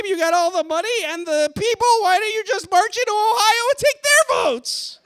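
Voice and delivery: high, loud mock toddler wail; hammed-up emotional outburst. Comic crying to crowd over Electoral College, mock-emotional. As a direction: cry it out loud like an overwrought toddler. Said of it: "I got a little emotional".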